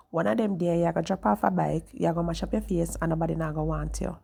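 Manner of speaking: retold warning in Jamaican Patois. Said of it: "So in Jamaican words".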